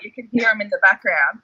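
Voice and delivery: normal talking voice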